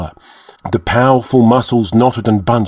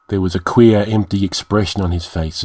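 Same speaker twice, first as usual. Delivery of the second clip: usual speaking voice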